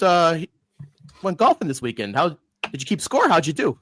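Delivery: normal talking voice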